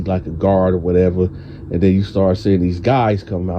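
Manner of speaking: ordinary delivery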